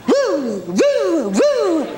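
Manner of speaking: high woo, each falling steeply; three high whoops sliding down; swooping woo sounds. Acted. Imitated noise in beach story. (des « wouh » aigus qui redescendent)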